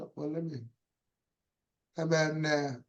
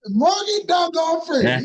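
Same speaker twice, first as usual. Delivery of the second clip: excited shout; 7 semitones higher, 6 dB louder than usual. Real, not acted. Shouts the name in delight.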